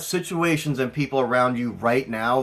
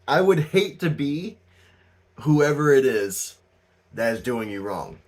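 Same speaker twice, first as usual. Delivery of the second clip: ordinary talk, nothing marked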